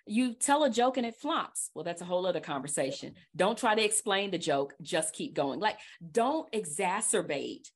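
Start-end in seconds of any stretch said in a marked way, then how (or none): none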